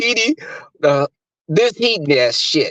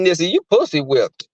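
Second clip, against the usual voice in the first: normal talking voice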